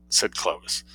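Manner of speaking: plain reading voice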